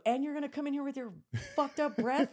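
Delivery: furious telling-off of the man with bad breath; imagined angry rant. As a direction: let loose the insult you never said. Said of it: "I didn't say that, but I wanted to".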